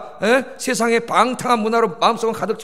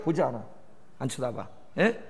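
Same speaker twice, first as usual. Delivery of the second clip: ordinary talk, nothing marked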